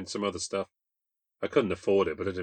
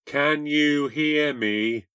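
calling over static; 6 semitones higher, 5 dB louder, much slower than usual. Acted. Shouting over a bad line.

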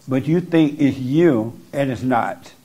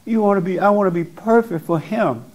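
higher, mock needy inner voice; voice of the lying thoughts; 5 semitones higher than usual. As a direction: mimic the whiny thought that wants to be perfect. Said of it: "And they're telling you".

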